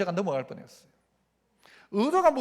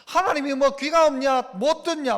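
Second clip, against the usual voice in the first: usual speaking voice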